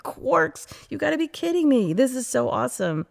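thrilled discovery. Retold or imitated. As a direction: relive the delight of learning about quarks. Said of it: "And I'm like".

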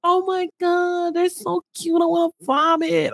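high, slow gush over a cute couple; mock gushing. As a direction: squeal as if it's too sweet to bear. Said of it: "I was like".

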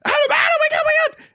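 high, loud imitation of panicked screaming; hammed-up frantic yelling; freaking out. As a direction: shriek as if trying to rouse someone unconscious. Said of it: "Brooke is freaking out".

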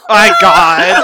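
loud laughing cry of disbelief. Real, not acted. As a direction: yell it out in delighted shock. Player overwhelmed by the joke.

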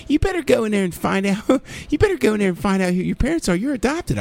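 high, mocking kid in a Maryland accent; taunting Maryland-accented impression. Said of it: "in that Maryland accent".

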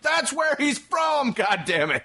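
high, slow comic outburst; mock exasperated shout. Viewer objecting to a silly TV premise, mock exasperation. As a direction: yell in mock outrage at the stupidity.

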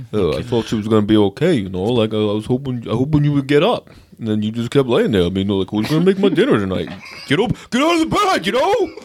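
put-on punch-drunk boxer pleading; Rocky impression. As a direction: mumble it like Rocky, then cry out to get up. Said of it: "retire my Rocky impression".

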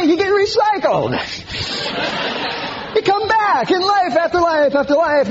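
high sing-song mock voice; mocking believer. Preacher parodying reincarnation believers, high mocking voice.

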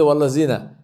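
"Wallazina" with a buzzing plain z; z in "Wallazina" where Arabic has th; Urdu-style z. Shown as a demonstration. Teacher repeating Urdu speakers' mistake, plain z. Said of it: "They will say".

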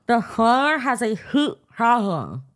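slow, mangled through a mouth stretcher; mouth stretched open. Real, not acted. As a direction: force words past pulled-back lips. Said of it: "hard to get the shit part out of your mouth".

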